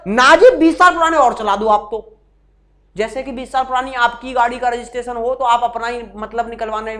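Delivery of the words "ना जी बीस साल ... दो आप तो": louder, irritated-sounding objection; mock angry retort. Teacher mimicking a student's heated answer, mock angry. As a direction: act out a cross student's comeback. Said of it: "गुस्से में बोल रहा है व्यक्ति".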